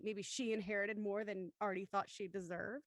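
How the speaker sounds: ordinary talk, nothing marked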